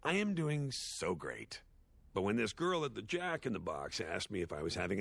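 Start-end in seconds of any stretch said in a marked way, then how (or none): 0.0-1.6 slow, higher, over-bright answer; mock cheerful. His usual answer when asked how he's doing, mock cheerful. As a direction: draw out a put-on "so great". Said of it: "I usually say".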